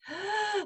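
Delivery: sharp high gasping inhale; gasp for breath. Shown as a demonstration. Breathwork teacher demonstrating the gasping inhale, sharp gasp. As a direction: suck the air in like you're drowning. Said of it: "like we're gasping for breath".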